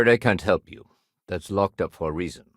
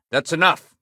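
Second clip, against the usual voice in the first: raised, higher, forceful voice; stern command; 8 semitones higher, 5 dB louder than usual. Acted. Officer ending a dispute with the functionary, stern raised voice. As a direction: put a firm stop to it.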